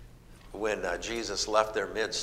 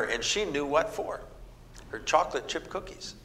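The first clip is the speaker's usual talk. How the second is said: ordinary talk, nothing marked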